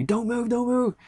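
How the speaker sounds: very high, held-back imitation of a yell; mock yelling. Ranger warning a climber rocking on the rope, mock yelling. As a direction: mimic someone yelling from above without real volume. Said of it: "he's yelling at me".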